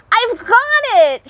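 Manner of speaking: very high, loud triumph; shout. Acted. Hedgehog to the others, shouting he has it. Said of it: "shouted the hedgehog".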